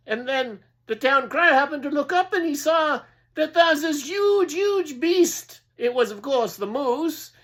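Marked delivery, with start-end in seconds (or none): none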